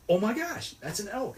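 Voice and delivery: friend's amazed cry, high-pitched; imitating excitement. Friend's first elk sighting retold.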